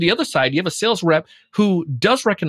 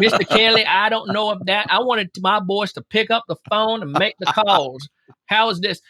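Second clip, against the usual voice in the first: comic Southern sales boss, pitched up; Southern US accent; 3 semitones higher than usual. Retold or imitated. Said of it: "They talk like that".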